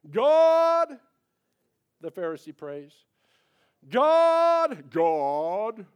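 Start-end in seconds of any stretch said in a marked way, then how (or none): 0.1-1.0 high, loud, showy prayer voice; pompous Pharisee voice. Acted. Preacher acting the boastful Pharisee praying, high and loud. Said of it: "I'll go back to that voice again". 3.9-5.9 high, loud, showy prayer voice; pompous Pharisee voice. Acted. Preacher acting the boastful Pharisee praying, high and loud. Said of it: "I'll go back to that voice again".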